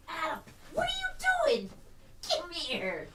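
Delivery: very high, soft coo to the dog; puppy talk. Real, not acted. Cooing at the puppy.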